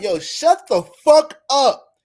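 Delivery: loud, high mock yell at his woman; bossing her around, hammed-up; man barking orders. Boyfriend yelling at Yvette to make tacos, hammed-up angry yell. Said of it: "her man being like".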